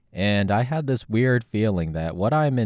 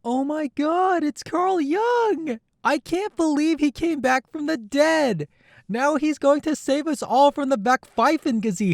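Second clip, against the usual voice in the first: a giddy fan gushing at a hero's return; starstruck high fan voice. Acted. Fan greets the returned Carl Jung with high squeals.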